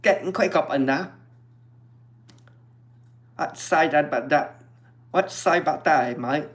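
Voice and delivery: half-hearted Cockney banter; mock Cockney. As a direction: keep the playful Cockney going. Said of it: "my Cockney accent".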